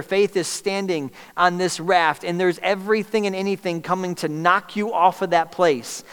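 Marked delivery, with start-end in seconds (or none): 3.8-6.0 loud, high, driving sermon delivery; full-force preaching. Acted. Preacher pressing the church to keep believing, full-force preaching. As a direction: preach it hard to the congregation.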